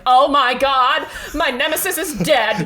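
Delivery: gleeful fake shock, laughing; hammed-up delighted rival; mock gleeful rival. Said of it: "Louise Sneed Hill is like".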